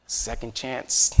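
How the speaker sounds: usual speaking voice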